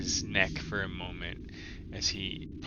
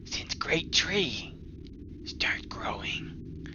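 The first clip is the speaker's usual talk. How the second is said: hushed goblin; 6 semitones higher than usual. Acted. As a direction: whisper the secret like a frightened goblin.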